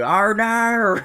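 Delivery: an American playfully imitating Aussie twang; mock Aussie accent. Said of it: "That's what I love doing".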